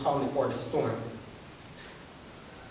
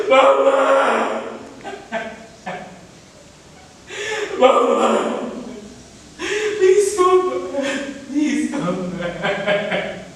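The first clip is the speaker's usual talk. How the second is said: wailing grief; 6 semitones higher, much slower than usual. Acted. Son wailing over his dead mother. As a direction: cry out for her to come back.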